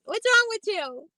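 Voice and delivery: real incredulous outburst. Real, not acted. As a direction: hit it in disbelief. Reacting to friend not blocking him.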